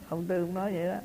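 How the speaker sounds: ordinary talk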